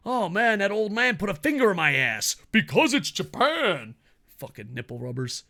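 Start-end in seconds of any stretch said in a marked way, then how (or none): 0.1-3.9 loud, high-pitched mocking quote; hammed-up, over-the-top fanboy defence; mock outraged fan. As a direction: parody a fan excusing anything, pitched up and loud. Mocking excuse-makers with acted quote.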